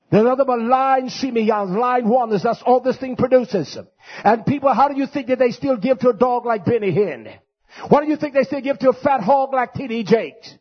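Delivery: high, loud pulpit thunder; fiery preacher rolling through accusations; full-force preaching. Real, not acted. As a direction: preach it hard to the congregation.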